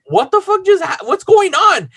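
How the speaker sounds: high-pitched baffled outcry; bewildered. Retold or imitated. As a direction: act out stunned confusion, voice shooting up. Bewildered bystander as fight starts.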